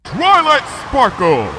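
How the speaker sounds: slow, high, loud bellow over the wind. A narrator's reading. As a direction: call out across a gale. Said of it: "bellowed Luna over the roar".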